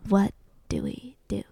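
hushed, breathless whisper. Retold or imitated. Lawyers asking each other quietly, whispered.

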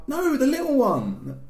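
very high, drawn-out protest; mock little-girl whine; upset little sister. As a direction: stretch it out like a wailing child. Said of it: "She was like".

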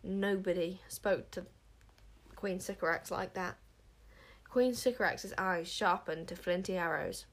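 usual speaking voice

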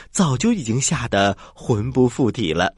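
usual speaking voice

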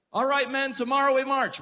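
high, loud rallying cry to the troops; rally the men like a general; booming rousing general speech. Acted.

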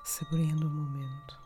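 calm guide with long pauses between breaths; guided meditation. Acted. (meditação guiada, voz suave e lenta)

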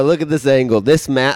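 loud, urgent retold demand; acted-out insistence, voice up in volume; insistent arguing. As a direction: argue your evidence forcefully. Acts out arguing with police.

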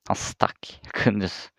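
hushed unvoiced whisper; a sudden whispered aside. Real, not acted. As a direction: drop to a whisper as if sharing a secret. Narrating friend vanishing at party.

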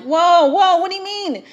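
high, loud startled whoa. Retold or imitated. Retelling the date moving pickup earlier, startled. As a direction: pull up short, alarmed.

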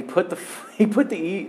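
giggling through the words; breaking into laughter mid-line; laughing. Real, not acted.

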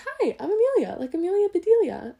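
high, loud, cheery friend voice; high bubbly girl. Retold or imitated. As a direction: bounce it up like a perky introduction. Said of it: "and she goes".

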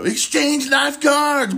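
high, slow mock movie-trailer voice; hammed-up credits announcer. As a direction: announce it like a cheesy film trailer. Reading the opening credits.

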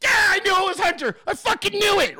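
gleeful vindication. Retold or imitated. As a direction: crow like a fan proven right. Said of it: "Cardildo's like".

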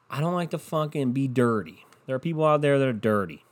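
normal talking voice

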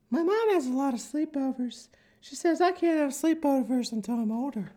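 put-on high, innocent child voice; little girl voice. Little girl telling strangers about her mom, high. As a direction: chirp it like a small girl. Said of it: "Little girl playing with your child".

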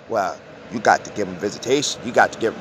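deadpan the court's ruling dismissively; mocking courts.

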